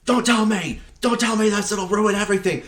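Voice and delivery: high, urgent protest; alarmed Doc. Retold or imitated. Doc stopping Marty from revealing the future, high and alarmed. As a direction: cut him off in a frantic rush. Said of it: "Doc is like".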